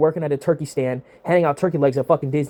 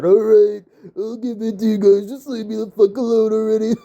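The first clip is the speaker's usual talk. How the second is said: Triple H caving; 4 semitones higher than usual. Retold or imitated. Host mocks Triple H caving to the internet, higher whiny mock voice. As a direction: whine it like a boss worn down by fans.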